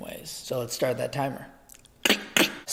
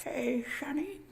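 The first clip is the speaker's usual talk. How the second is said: put-on high, quiet old granny voice; grandma voice; 12 semitones higher, 7 dB quieter than usual. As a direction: croon it like a doting grandma. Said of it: "Think like a half grandma mouth".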